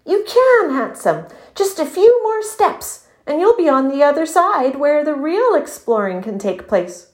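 high, eager coaxing; encouraging friend. A narrator's reading. Dwayne urging his stuck friend across the river, encouraging.

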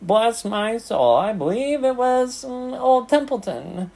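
high-pitched, self-important recital; taunting. A narrator's reading. Rat reminding pig of his favours, taunting. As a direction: crow over each past favour. Rat boasts of past services.

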